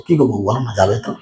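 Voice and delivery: king cuddling his wife, pleading softly and lovingly; coaxing tenderly. Retold or imitated. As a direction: sweet-talk the wife into agreeing. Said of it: "গলাটা জড়িয়ে ধরে আদর করে বলছে".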